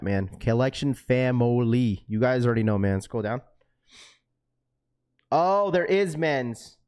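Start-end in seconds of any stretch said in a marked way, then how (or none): 0.4-2.0 drawn out, leaning on Collection family; slow, stressed Collection family. Shown as a demonstration. Streamer stressing the family name to chat, slow and weighted. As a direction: say Collection family slowly, every word weighted. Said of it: "emphasize the word family in this chat".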